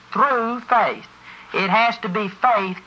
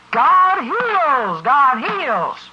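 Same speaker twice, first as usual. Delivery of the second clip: higher, louder mock preacher shout; mock holler; 5 semitones higher, 3 dB louder, much slower than usual. Preacher mocking sermons that only shout, hollering. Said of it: "shout and yell and hoop and holler".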